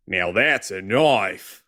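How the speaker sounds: slow, drawn-out Australian accent; fake Aussie accent. Shown as a demonstration. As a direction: put on an exaggerated Aussie accent. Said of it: "I'll fake an Aussie accent".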